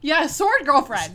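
loud, high, drawn-out fan shout; excited cheer. Real, not acted. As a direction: whoop it with glee, stretching the words.

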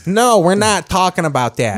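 Chicago boss shutting down a question; mock Chicago accent. Reporter question refused in mock Chicago accent. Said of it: "just do a Chicago accent of Rocky Wirtz".

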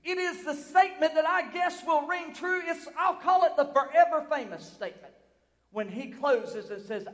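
usual speaking voice

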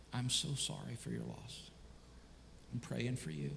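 very soft, low condolences; quiet reverent tone. Acted. Mourner to a bereaved family at a funeral home, hushed and reverent. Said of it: "talking in a nice, quiet, reverent tone".

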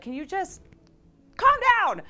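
put-on climbing to a loud, high outburst at a toddler; exasperated parent yell. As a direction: lose patience with the two-year-old, half-shouting. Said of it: "and then you're like".

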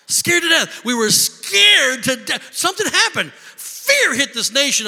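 shouted emphasis. Acted. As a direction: hammer the words home. Preacher stresses panic.

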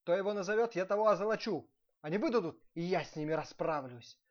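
loud, high-pitched proclamation; commanding. A narrator's reading. Prince ordering his steward to gather everyone, loud command. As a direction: announce the decree at full voice. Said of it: "приказал князь управителю".

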